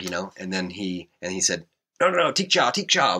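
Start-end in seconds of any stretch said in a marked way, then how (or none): 2.0-3.2 high, quick reassuring nos; eager reassurance. Retold or imitated. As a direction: rush to calm him down, pitch way up. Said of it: "he could see in my face that I was kinda bummed".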